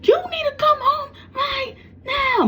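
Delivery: high, tearful mother yelling; a mom sobbing as she orders her child home; crying shout. Retold or imitated. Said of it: "She's like".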